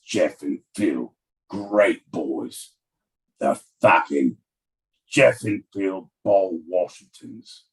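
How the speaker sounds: put-on disown the boy in a low growl of contempt; stern disowning father. Dying father cutting George out of the will, stern.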